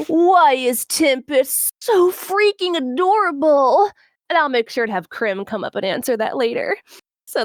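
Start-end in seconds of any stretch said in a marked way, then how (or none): 0.0-3.9 slow, swooning gush. Retold or imitated. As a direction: gasp then gush over cuteness. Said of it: "an individual from a server that said".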